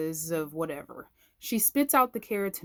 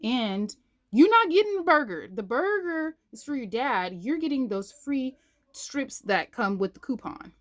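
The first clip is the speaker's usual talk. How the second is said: higher, louder firm telling-off; stern scolding; 6 semitones higher, 4 dB louder than usual. Retold or imitated. Mother retelling how she scolded her tantrum son, firm. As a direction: lay down the law to a child.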